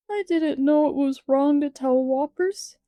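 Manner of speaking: put-on high child's voice, sobbing the words out. A narrator's reading. Small boy sobbing his apology to Anne, tearful. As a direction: blurt it through childish sobs. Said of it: "he sobbed".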